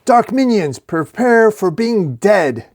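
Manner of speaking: high, loud war cry, slowing at the end; battle threat. A narrator's reading. Wizard threatening the dark minions, loud battle cry. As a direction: proclaim doom loudly and grandly.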